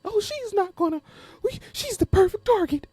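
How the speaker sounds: mocking impression of the people targeting her; mocking the schemers. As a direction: play the plotters as smug and silly. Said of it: "like I'm just a little girl".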